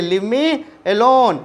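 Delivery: very slow, each word apart; slow dictation. Shown as a demonstration. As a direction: dictate it for students to note. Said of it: "जल्दी से इसके notes कर लीजिए". (धीरे-धीरे लिखवाते हुए)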